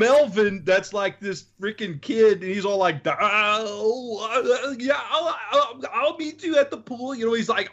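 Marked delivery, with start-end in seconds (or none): 3.1-7.1 high, fumbling dopey voice; higher dopey stammering voice; stupid talking kid. Retold or imitated. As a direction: stammer like a clueless kid, pitched up. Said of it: "all freaking stupid talking".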